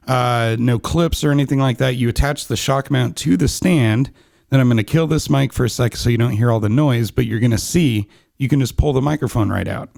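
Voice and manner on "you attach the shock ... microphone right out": normal talking voice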